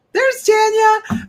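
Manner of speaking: high, slow voice for the dogs; dogs' voice. Retold or imitated. Woman voicing her dogs watching her, high and slow. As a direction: voice the puzzled dogs. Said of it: "the dogs are like".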